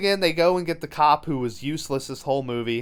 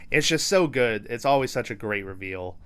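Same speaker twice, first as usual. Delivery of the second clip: ordinary talk, nothing marked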